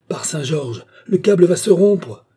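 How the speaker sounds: high, building to loud cry; alarmed shout. A narrator's reading. Stangate hears the cable tearing, shouting in alarm. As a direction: shout a sudden warning. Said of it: "cria Stangate".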